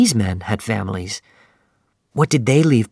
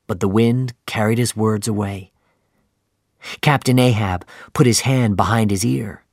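usual speaking voice

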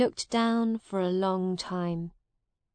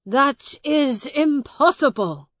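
high, loud, indignant protest; shouted disbelief; 6 semitones higher, 8 dB louder than usual. A narrator's reading. Miles answers the brother who denies him, shouting. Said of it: "shouted Miles".

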